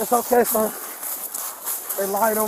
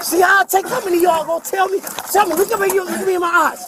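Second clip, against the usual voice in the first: yelling; 6 semitones higher, 4 dB louder than usual. Real, not acted. Agitated man yelling at officers.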